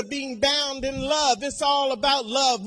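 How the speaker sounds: ordinary talk, nothing marked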